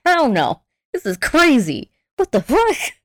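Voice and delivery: retold outraged refusal, playfully; incredulous.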